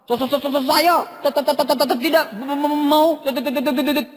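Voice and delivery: rapid repeated syllables before each word; machine-gun stammer, stuck on first sounds; stuttering speaker. Retold or imitated. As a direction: imitate a heavy stutter. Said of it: "pembicaranya gagap".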